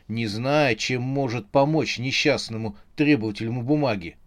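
ordinary delivery, nothing marked

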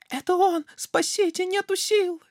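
very high, loud shriek; terrified fox. Acted. Fox fleeing the gruffalo, high shriek. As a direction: scream for help.

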